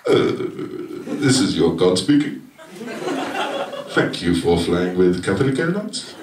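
low pilot announcement voice; airline captain. Pretend. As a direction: speak as a captain over the intercom. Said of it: "like when a captain makes an announcement on an airplane".

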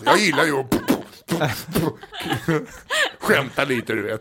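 mock tough playful voice. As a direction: tease her with a mock tough voice. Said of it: "det ser ut som att du skulle fightas".

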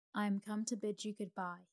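usual speaking voice

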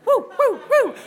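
high, loud repeated woos; string of party whoops; whooping. Retold or imitated. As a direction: whoop along like a hype crew. Whooping at the retold car wash.

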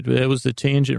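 ordinary talk

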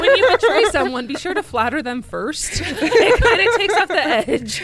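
giggling through the words; laughing. Real, not acted. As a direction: laugh while you say it. Laughing joke advice.

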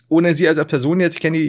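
ordinary delivery

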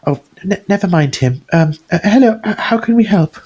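high, fluttery nervous shopkeeper voice; wiry woman. Acted. Game master voicing the flustered smith's wife. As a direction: play her flustered and eager to please.

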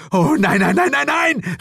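high, loud rising panic; high frantic repeated no; alarmed cry. A narrator's reading. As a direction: chase the toads in horror. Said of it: "rief ich".

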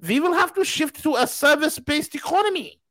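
a hint of a mock politician voice; mocking the chancellor. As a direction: parody the chancellor announcing it. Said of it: "he's like".

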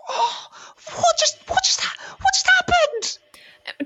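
very high, breathless confused man; dazed high voice. Acted. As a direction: stammer it out, dazed. Dazed NPC voice.

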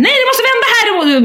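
high mock yell at the driver; retold yell. Wife in the car yelling at her husband to turn, mock yell. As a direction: snap the order out, high and sharp. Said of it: "jag skriker och gapar".

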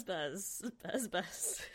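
ordinary talk, nothing marked

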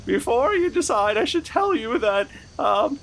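high squeaky clown voice; whiny high-pitched clown; silly clown. Acted. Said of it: "That's what clowns sound like".

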